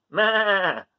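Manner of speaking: bleating a dismissive meh; goaty meh. Acted. Gregory refusing breakfast, a bleating meh. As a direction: turn up your nose with a bleat. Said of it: "said Gregory".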